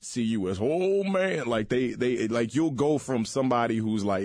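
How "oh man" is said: high, drawn-out mock dismay. Mimics twenty-somethings pitying him.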